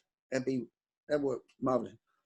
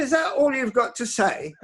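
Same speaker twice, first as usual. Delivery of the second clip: higher, louder, mock-indignant challenge; indignant retort; 7 semitones higher, 4 dB louder than usual. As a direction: throw the question back at him, half-acted. Retold confrontation with rival Ron.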